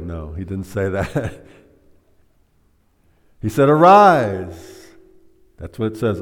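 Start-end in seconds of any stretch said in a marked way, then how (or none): 3.7-4.9 loud, high commanding call; a booming resurrection command; forceful command. Retold or imitated. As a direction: bellow it like raising the dead. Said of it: "spoken forcefully and loudly".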